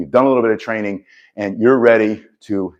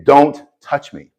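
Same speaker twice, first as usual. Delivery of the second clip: loud, higher and slow, each word set down hard; firm command; 5 semitones higher, 5 dB louder, slower than usual. Shown as a demonstration. To an attacker, not a soft plea but a firm command. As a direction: bark it so there is no doubt. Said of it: "don't say please ... say it in a soft voice. Say".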